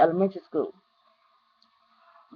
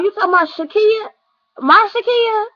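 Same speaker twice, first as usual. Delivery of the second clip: stunned realization; 10 semitones higher than usual. Retold or imitated. Realizing which Kia died.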